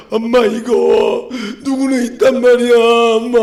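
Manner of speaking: put-on slow, pleading kid voice; mock a child begging mum, dragging the words out; drawn-out imitation of a wheedling kid; whining child.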